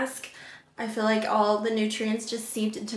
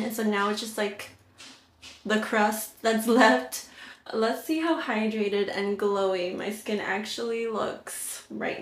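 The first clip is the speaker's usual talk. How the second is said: lips barely moving; restricted mouth movement; talking with a stiff face mask. Real, not acted. Seen 0: Thick crusted orange mask covers face and lips while she speaks.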